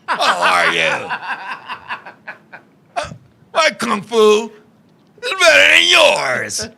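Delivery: halting dubbed martial-arts hero, long pauses; stilted kung-fu film challenge, stretched at the end; mock kung-fu movie voice. Boyfriend showing off his movie Chinese to her father, mock kung-fu voice. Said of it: "the only Chinese I knew was with the, with the Kung Fu movie joints".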